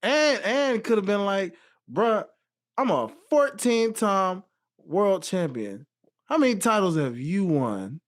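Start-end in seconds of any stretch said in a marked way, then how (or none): 1.9-8.0 slow, emphatic bragging comeback; raised voice, drawn-out indignant boast; imagined boastful retort. Retold or imitated. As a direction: lay out each credential slowly and pointedly.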